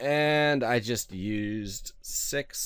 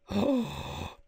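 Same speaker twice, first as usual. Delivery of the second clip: sharp, high intake of breath; startled gasp. Real, not acted. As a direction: gasp at a sudden sighting. Spots the pigs.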